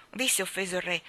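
usual speaking voice

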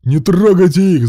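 high, very loud plea; screaming. A narrator's reading. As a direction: scream to protect them. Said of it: "завопил Руслан".

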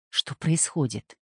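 usual speaking voice

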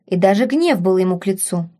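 ordinary delivery

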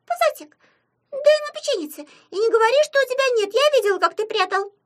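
very high, loud, fast squeak; bossy little imp. A narrator's reading. Imp ordering Puzatik to share his cookie, bossy squeak. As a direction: scold in a piping cartoon voice, quick and loud.

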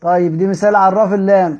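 usual speaking voice

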